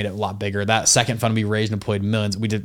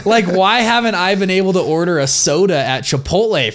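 very high and louder, mock outrage at dad; outraged disbelief; 9 semitones higher, 6 dB louder, slower than usual. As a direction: squeal it like a betrayed kid. Confronting dad about hidden money.

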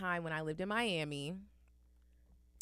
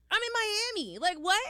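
high, louder incredulous squeal; shocked disbelief; 13 semitones higher, 6 dB louder than usual. Retold or imitated. Telling the mom she's dressed for Miami, high incredulous squeal.

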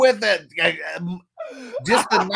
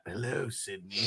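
low killer voice; 8 semitones lower, 12 dB quieter than usual. Retold or imitated. Quoting killer's phone line.

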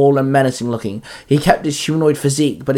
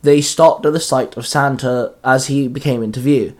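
ordinary delivery

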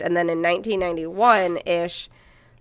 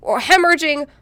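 loud angry rant; 10 semitones higher, 6 dB louder than usual. Real, not acted. Ranting about the cult.

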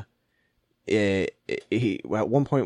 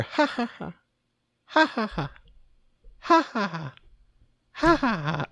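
echoing distant laughter; 12 semitones higher than usual. Retold or imitated. Patient mimics laughter heard on nitrous, high and eerie. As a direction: imitate a far-off laugh echoing in a drugged head. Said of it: "somebody starts laughing in the background".

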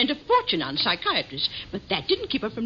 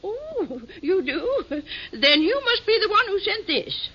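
tittering; 9 semitones higher than usual. Acted. Old lady tittering at visitor.

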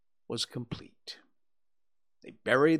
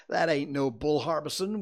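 ordinary delivery, nothing marked

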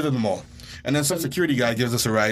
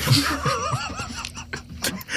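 high laugh; 3 dB quieter than usual. Real, not acted. Laughing at own story.

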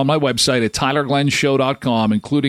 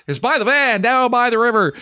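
joking movie quote; 10 semitones higher than usual. Shown as a demonstration. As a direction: ham it up like a famous quote.

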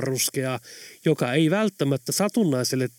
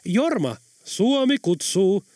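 high-pitched playful summons, drawn out; cheerful; 7 semitones higher, 3 dB louder, slower than usual. A narrator's reading. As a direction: sing out the call merrily. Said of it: "jatkoi iloisella äänellä". (iloinen, venytetty ja korkea kutsu)

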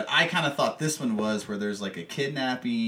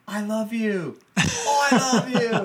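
much higher and louder, gushing "I love you"; gushing love call; 13 semitones higher, 7 dB louder, slower than usual. Retold or imitated. Worker passing on a love message across the subway, loud and high. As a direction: shout the love across the crowded car.

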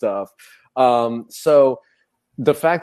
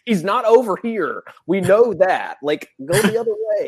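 exasperated crew; 9 semitones higher than usual. Retold or imitated. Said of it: "be like".

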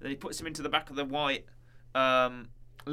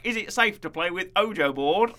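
imitated British read-out, pitched up; higher, louder mock British question; British accent; 4 semitones higher, 5 dB louder than usual. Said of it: "he reads it in a British accent".

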